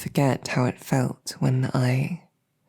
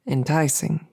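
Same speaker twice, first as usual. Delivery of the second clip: ordinary talk, nothing marked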